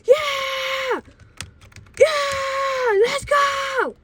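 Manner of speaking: long, very high cheering screams; all excited. Retold or imitated. Kids celebrating the driver's slip, excited high screaming. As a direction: whoop like a kid freaking out. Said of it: "all excited and everything".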